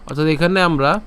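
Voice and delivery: ordinary talk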